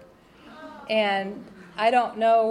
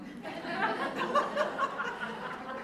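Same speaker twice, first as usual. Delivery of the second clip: high laugh; 13 semitones higher, 8 dB quieter than usual. Real, not acted. Audience laughs after bed joke.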